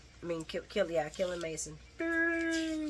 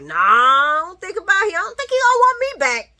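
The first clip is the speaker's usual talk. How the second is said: dismissive Ava; 11 semitones higher, 12 dB louder than usual. Retold or imitated. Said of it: "Ava's like".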